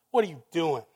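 ordinary delivery

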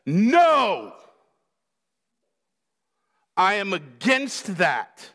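loud, drawn-out acted refusal; visceral no. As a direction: burst out with the no, then state it firmly. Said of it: "This is a visceral".

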